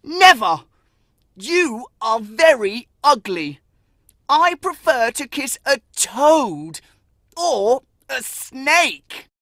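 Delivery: cruel refusal. Acted. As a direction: sneer and drag out the last words. Boy cruelly refuses his mother.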